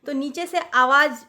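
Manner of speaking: normal talking voice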